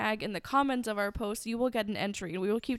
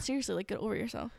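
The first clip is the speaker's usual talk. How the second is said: quick, soft sarcastic dismissal; 6 dB quieter, faster than usual. Pretend. Mock witch hunter brushing off victims, sarcastic. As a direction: toss it off sarcastically, fast. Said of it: "Sarcasm, my God".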